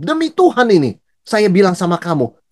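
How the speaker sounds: high, emphatic oath with the pitch jumping up; mock church swearer. Preacher mimics a churchgoer swearing to a friend, high and emphatic. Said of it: "Kalau di gereja ada orang ngomong".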